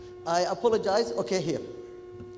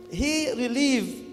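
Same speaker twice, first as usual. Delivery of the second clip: Kuwaiti English; 4 semitones higher, 3 dB louder, slower than usual. Retold or imitated. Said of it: "talking, uh, Kuwaiti English".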